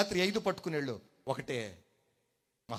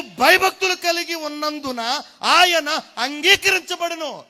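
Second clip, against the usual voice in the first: loud, slow, ringing sermon voice; full-force preaching; 9 semitones higher, 11 dB louder, slower than usual. Acted. Preacher to congregation, reading Hebrews in a loud, slow voice. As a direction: thunder each word of the verse out.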